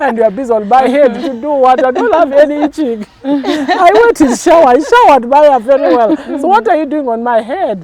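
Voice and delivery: high, loud, laughing grumble as the husband; laughing mock complaint. Pastor voicing an annoyed husband, laughing and loud. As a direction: laugh through the complaint about hair.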